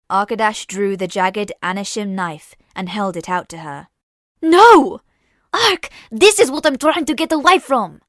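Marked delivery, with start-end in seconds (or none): 4.4-8.0 loud, high, frantic refusal; desperate shout. Acted. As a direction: scream it in horror.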